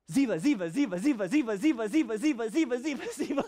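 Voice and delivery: a fast singsong string of her name, rising as it goes; playful rapid-fire name chant; rapid repeated name. Retold or imitated. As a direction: chant her name over and over, teasing, right by her ear. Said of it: "I leaned right up to her and I went".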